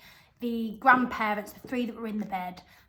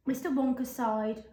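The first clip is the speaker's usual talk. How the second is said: usual speaking voice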